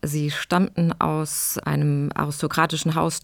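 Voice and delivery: usual speaking voice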